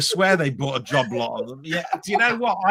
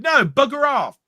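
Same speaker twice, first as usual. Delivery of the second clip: loud brush-off; 8 semitones higher, 4 dB louder than usual. Retold or imitated. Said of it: "And she's going".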